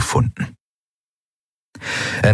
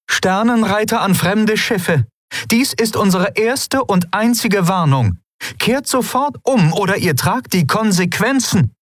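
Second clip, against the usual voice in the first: stern warning; 13 semitones higher than usual. Acted. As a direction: read the bluff as an official threat. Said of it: "blickte streng".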